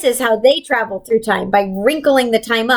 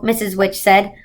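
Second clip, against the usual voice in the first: plain reading voice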